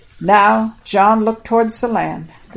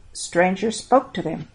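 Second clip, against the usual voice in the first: usual speaking voice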